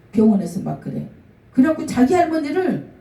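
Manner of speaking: ordinary delivery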